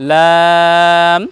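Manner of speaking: লাম held long and slow; teacher modelling the letter slowly; drawn-out letter name. Shown as a demonstration. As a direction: sing out লাম with a long vowel. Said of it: "আমরা উচ্চারণ করি".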